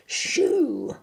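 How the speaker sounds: high, loud mock shout. Boy shouting at the dragons, loud high shout. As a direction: shoo them off with a yell. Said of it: "I shouted".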